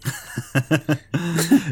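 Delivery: high-pitched laugh. Real, not acted. Co-host laughs at the scolding of Gary Oldman, high laugh.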